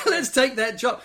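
high, laughing push to take it; laughing urge. Retold or imitated. Wife urging, laughing.

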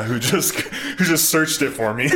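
giggling through the words; laughing. Real, not acted. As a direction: laugh it out, embarrassed. Laughing at own mix-up.

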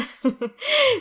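high nervous giggle; a burst of nervous laughter. Real, not acted. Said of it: "c'est un rire nerveux".

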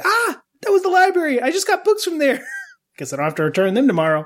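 high, shocked exclamation; surprised. Retold or imitated. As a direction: squeal it out in disbelief. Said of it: "I was like".

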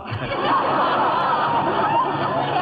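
high, triumphant burst of laughter; gleeful cackle. Acted. Gloating over Mister Stanley, gleeful high cackle. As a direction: crow with delight at his victory.

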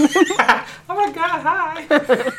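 very high, slow, giggly gush; gushing greeting. Pretend. Answering a request to wave, high slow giggly gush. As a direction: coo it out like a starstruck fan.

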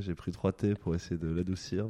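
normal talking voice

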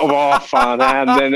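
high, slow, laughing at the memory; replaying his own shock through laughter; laughing disbelief. Player retelling airport guard to podcast host, laughing high. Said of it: "Så jag bara".

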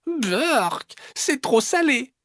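very high "yuck" voice; disgusted little fox. Acted. Little fox tasting salty soup, high-pitched disgust. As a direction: squeal in disgust at the taste.

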